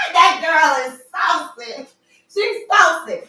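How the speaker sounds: gleeful loud praise; excited shout. Real, not acted. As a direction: hype it up after laughing. Laughing, hyped about the song.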